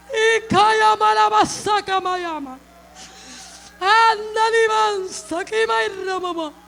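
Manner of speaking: loud, slow, drawn-out cries of worship; ecstatic praise. Real, not acted. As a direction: cry out in tongues at full force.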